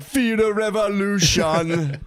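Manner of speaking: high, slow, laughing rock-singer voice; mock stoner singer. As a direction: drawl it like a stoner frontman. Kid imitating band in basement.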